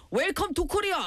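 high, put-on Korean gangster English; a Korean gangster greeting in English; Korean-accented gangster line. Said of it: "like this".

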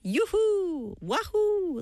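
high playful yoo-hoo; bright high wahoo call; cheery whoop. Shown as a demonstration. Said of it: "like this".

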